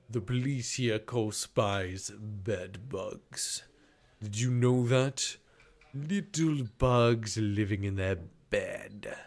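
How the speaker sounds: slow, deliberate interrogator's voice; deep resonant man. Acted. Man tells spy about bed bugs, deep resonant voice. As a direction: draw the words out in a put-on man's voice. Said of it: "A man's voice, deep, resonant".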